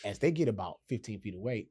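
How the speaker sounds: usual speaking voice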